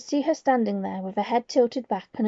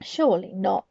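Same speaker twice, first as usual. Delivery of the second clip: ordinary delivery, nothing marked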